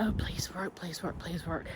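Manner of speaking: softly muttered retold plea; hushed, hopeful wishing to oneself; quiet pleading. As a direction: plead under your breath, retelling it. Retelling silent hopeful plea before injection.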